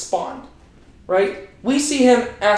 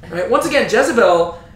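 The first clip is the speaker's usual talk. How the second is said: ordinary talk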